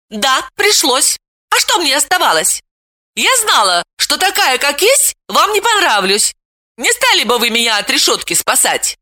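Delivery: angry cry. A narrator's reading. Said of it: "Вета зло сверкнула глазами и крикнула".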